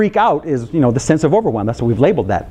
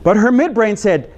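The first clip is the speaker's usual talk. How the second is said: ordinary talk, nothing marked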